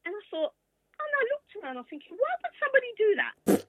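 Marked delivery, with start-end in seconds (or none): 2.2-3.7 baffled. Retold or imitated. Acting out baffled thought.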